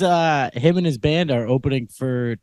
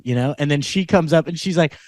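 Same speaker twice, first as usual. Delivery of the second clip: ordinary delivery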